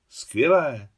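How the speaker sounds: gladly enthusiastic approval; delighted. A narrator's reading. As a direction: exclaim it with sudden delight. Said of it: "zaradovala se Lulu".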